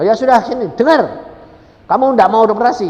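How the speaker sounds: high, loud, forceful questioning; commanding shout. Retold or imitated. Preacher making the frightened woman listen, commanding shout. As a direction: order them to listen, loudly. Orders the crying patient, retold.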